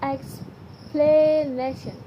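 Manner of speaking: "explanation" stretched out slowly, each syllable spelled out; slow careful reading. Shown as a demonstration. Teacher reading the word list aloud to the class, slow and deliberate. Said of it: "Read the following twenty items aloud".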